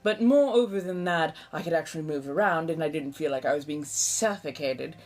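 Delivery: put-on a lightly held eighteenth-century colonial American accent; a mild colonial American accent, chatty and theatrical. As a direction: keep the old colonial American lilt going as you chat. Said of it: "the accent is, uh, roughly seventeen hundreds colonial American".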